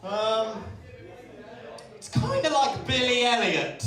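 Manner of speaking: high, loud, slow theatrical shout; booming, drawn-out promoter voice; flyer man. Retold or imitated. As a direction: proclaim it with hammed-up grandeur. Flyer man describes his play.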